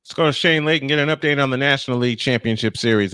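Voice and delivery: ordinary talk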